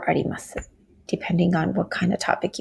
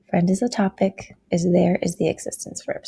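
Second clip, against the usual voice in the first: ordinary delivery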